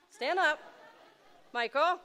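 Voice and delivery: loud, high call across the room. Real, not acted. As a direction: call him up from the stage. Calling son from stage.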